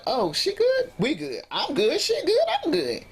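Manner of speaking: high-pitched, jumpy insistence; agitated reassurance. Retold or imitated. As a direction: act out an overexcited reply. Acted agitated reply to cousin's challenge.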